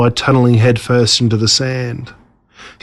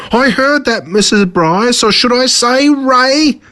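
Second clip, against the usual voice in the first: much higher mocking call; villain shouting; 11 semitones higher, slower than usual. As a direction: call out the secret name with a sneer. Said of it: "Mister Motcher shouted".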